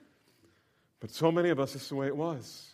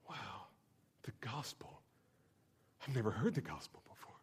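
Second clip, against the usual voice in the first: awed hush; 2 semitones lower, 11 dB quieter than usual. Retold or imitated. Retelling moment of conversion.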